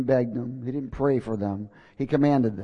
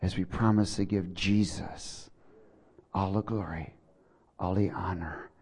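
prayer; 5 semitones lower than usual. Acted. Pastor leading the congregation in prayer, hushed and fading. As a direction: pray them into the throne room, fading down.

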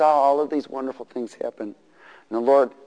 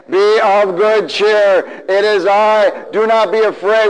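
loud, very slow proclamation; preached Jesus, loud and drawn out; 6 semitones higher, 5 dB louder, slower than usual. Acted. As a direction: call out reassurance across the storm. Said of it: "everybody say it".

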